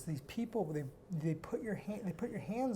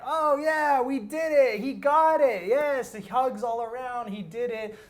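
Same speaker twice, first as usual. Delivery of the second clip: cheering; 9 semitones higher, 13 dB louder, slower than usual. Retold or imitated. Church group celebrating his tongues.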